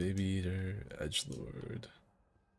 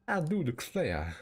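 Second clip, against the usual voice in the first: put-on old southern gentleman; 5 semitones higher than usual. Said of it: "talk like an old southern slave owner".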